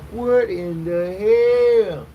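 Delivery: slow, drawn-out shocked question; mock disbelief. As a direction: stretch it out in stunned disbelief. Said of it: "And I'm like".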